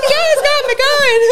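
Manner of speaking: high, slow mock chat-up voice; cheesy flirt voice. Mimicking the chat line.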